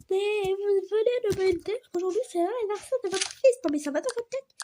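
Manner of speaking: high, loud indignant protest; mock indignation. Retorting about the son's birthday in an acted shop quarrel, indignant. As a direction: exclaim it high, as if offended.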